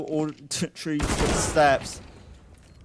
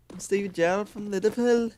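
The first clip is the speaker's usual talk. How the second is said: put-on jokey Scouse accent, higher; Stevie Gerrard Scouse accent; 6 semitones higher than usual. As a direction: ham up a Liverpool accent for a laugh. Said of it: "that sounds more Scouse".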